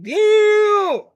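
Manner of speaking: high, loud drawn-out "damn"; stunned and loud, pitch jumping up; shocked reaction. Retold or imitated. Reacting in retold fight story.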